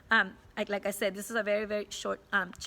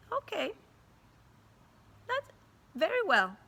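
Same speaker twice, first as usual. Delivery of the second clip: higher, knowing, game-on tone; you know his game, play along; knowing teacher; 6 semitones higher, faster than usual. Retold or imitated.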